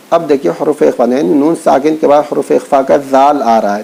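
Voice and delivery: ordinary talk, nothing marked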